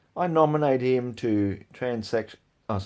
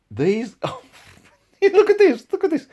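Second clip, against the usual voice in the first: laughing; 6 dB louder, slower than usual. Real, not acted. Laughing in disbelief at comment.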